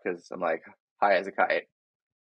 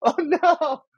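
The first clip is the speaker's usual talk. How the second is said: very high, louder mock cry; mock alarm; 6 dB louder than usual. Patient reliving the needle missing his spine, high mock alarm. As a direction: squeal it in play-acted dismay. Acts out his reaction to miss.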